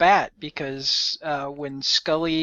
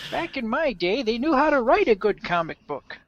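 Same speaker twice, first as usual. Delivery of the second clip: put-on old man voice; 8 semitones higher than usual. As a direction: grumble like a cranky old man. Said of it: "you should say that in the old man voice".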